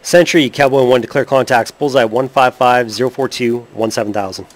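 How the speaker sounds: loud, crisp military radio call; pilot radio call. Acted. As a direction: call it in like a fighter pilot on comms. Player makes loud radio call.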